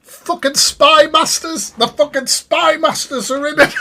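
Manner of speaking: high, loud disbelieving cry of spy masters; incredulous mocking exclaim. Real, not acted. Streamer mocking the clip's spy master claim, incredulous high exclaim. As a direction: scoff at the absurdity, voice shooting up.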